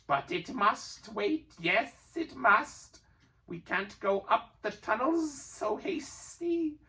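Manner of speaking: high, slow creature voice; high, slow sly creature; scheming Gollum. A narrator's reading. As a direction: stall with a plan.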